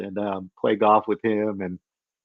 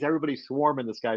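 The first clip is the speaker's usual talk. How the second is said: ordinary talk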